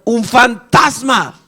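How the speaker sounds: high, drawn-out mock scream; terrified cry. Disciples seeing a figure walking on water, acted frightened cry. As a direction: cry out in acted terror, stretching the words. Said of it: "empezaron a gritar".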